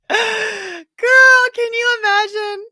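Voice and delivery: put-on sassy girl voice.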